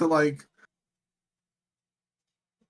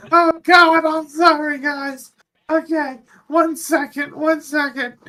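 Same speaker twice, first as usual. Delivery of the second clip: high, louder flustered pilot voice; flustered apology; 11 semitones higher, 6 dB louder than usual. Retold or imitated. Pilot apologizing for spilling soda on astronauts, high flustered voice. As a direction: apologize in a panicky high squeak. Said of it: "And he's like".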